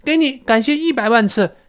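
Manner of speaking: gushing mock gratitude; exaggerated thanks. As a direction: overdo the thanks, stretching each word. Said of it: "甚至夸张一点说".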